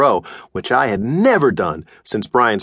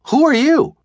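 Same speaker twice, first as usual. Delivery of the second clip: put-on high, sudden boy's cry of shock; a boy startled by a stranger on his bed; startled blurt. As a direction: blurt it out, caught off guard. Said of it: "I blurted out".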